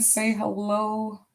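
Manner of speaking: ordinary talk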